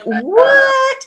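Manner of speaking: very high, incredulous rising question; mock disbelief. Host amazed at her guest's leap to Ironman, incredulous. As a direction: squeal it in playful amazement.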